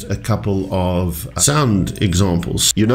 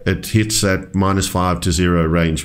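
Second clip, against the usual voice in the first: ordinary delivery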